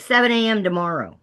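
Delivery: high, loud retort; retold emphatic reply; blurted demand. Client snaps back the meeting time.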